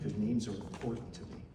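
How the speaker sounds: ordinary delivery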